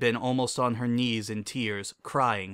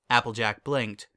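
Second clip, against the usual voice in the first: ordinary delivery, nothing marked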